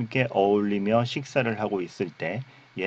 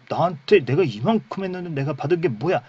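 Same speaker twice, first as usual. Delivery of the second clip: higher-pitched, acted indignant complaint; aggrieved older brother; 7 semitones higher, 4 dB louder than usual. Older brother demanding his reward, acted grievance. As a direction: protest like a slighted son.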